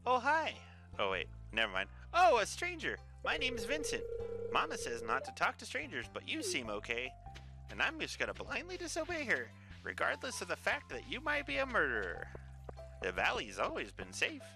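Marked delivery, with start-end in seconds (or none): 0.0-0.5 surprised. Real, not acted. Startled high greeting. 2.1-14.4 put-on high squeaky child voice; little kid voice. Voicing the child Vincent.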